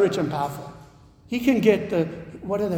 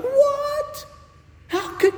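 squeaky high disbelief, much higher; shocked what; slower than usual. Retold or imitated. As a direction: gasp out a shrill, stunned what. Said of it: "And you're like".

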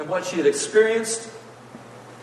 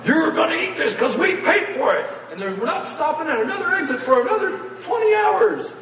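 much higher, scolding parent voice; threatening parents; 12 semitones higher than usual. Retold or imitated. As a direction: scold the kids like a fed-up parent. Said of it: "your mom and dad start threatening you".

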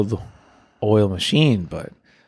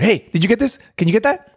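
high, insistent calls from coworkers; a pestering coworker calling across the floor, much higher; mock pushy coworker; 11 semitones higher, 3 dB louder than usual. Server imitating coworkers nagging him mid-shift, high calling voice.

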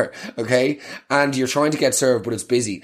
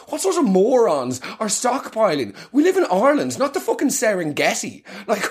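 climbing much higher, incredulous; building rant; 7 semitones higher than usual. Real, not acted. Ranting to listeners about stockpilers, climbing much higher. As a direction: rise to exasperated disbelief.

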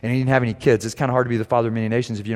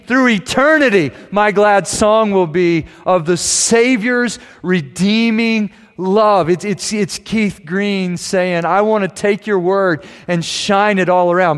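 loud, high, slow preaching climax; preaching at full force; 9 semitones higher, 8 dB louder, slower than usual. Acted. Pastor lifting the hymn lyrics to the church, loud and soaring. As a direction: belt the hymn words out to the congregation.

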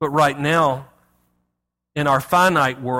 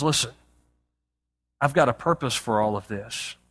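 gentle reassurance; 6 semitones lower, 6 dB quieter than usual. Retold or imitated. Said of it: "He says".

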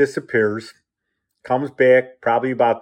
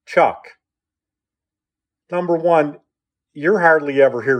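emphatic, higher retold scolding; firm telling-off; 5 semitones higher, 4 dB louder than usual. Mother confronting her husband over the boys, firm. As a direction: retell a mother laying down the law.

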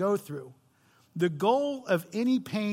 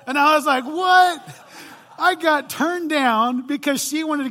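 higher, louder shocked outburst; 7 semitones higher, 7 dB louder than usual. Retold or imitated. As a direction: blurt it out in disbelief. Shocked at being turned down.

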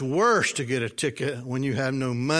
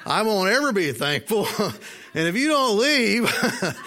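laughing through it; 7 semitones higher, 4 dB louder than usual. Retold or imitated. Retelling his stubborn thoughts, laughing.

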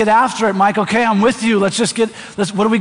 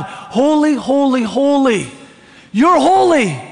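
higher-pitched seraph's cry of praise; 4 semitones higher, slower than usual. Acted. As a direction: proclaim it upward, imitating a seraph. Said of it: "that seraph that's crying out to God".

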